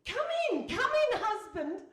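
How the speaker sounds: high, loud eager call of come in; loud welcome. Acted. Wife calling husband in from the doorway, loud and eager.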